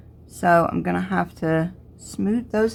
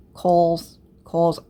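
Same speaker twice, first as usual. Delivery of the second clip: southern coils. Real, not acted. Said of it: "if it's my southern accent".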